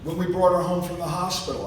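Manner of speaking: ordinary talk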